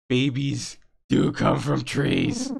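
slow, loud, awed; excited revelation. Acted. Friend realizing where babies come from. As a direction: proclaim it in amazement.

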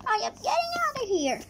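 fast, high panicked flight. Acted. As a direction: blurt it out while running. Fleeing, fast.

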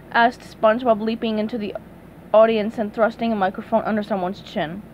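plain reading voice

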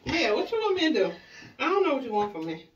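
usual speaking voice

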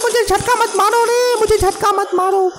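very high, panicked cry not to be shocked; panicked yelp. Real, not acted. Gamer begging the monster not to shock him, high panicked squeal. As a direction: squeal like you're being zapped.

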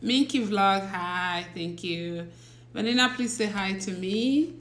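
ordinary talk

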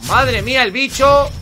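slow, high, loud astonishment; loud amazement. Real, not acted. Gamer seeing the creature, loudly amazed. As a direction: exclaim it big, high and drawn out. Louder repeat about the creature.

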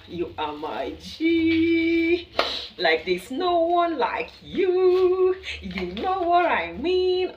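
high, loud, long-held vowels; gushing, drawn-out praise. Real, not acted. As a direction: stretch it out high and slow, hyping your loyal fans. Gushing over returning viewers in drawn-out tones.

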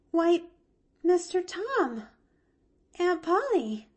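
put-on high girl's voice, halting; a startled child stammering. A narrator's reading. Woken girl on the roof stammers at the adults, high. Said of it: "she stammered".

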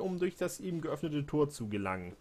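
ordinary talk

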